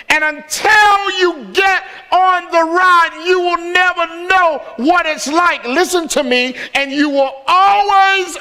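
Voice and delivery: high, shouted preaching with stretched key words; full-force preaching. Real, not acted. Pastor warning the congregation against missing God's best, shouted preaching. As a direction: hammer it home to the congregation.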